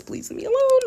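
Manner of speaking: high squeaky mock plea; mock whiny plea. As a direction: whine it like a playful brat. Joking demand for only five stars.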